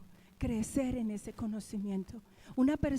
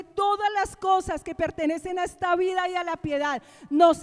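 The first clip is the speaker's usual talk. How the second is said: loud, raised proclamation; forceful preaching; 6 semitones higher, 7 dB louder than usual. Real, not acted. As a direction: drive the lesson home to the congregation. (prédica enérgica, voz alta y elevada)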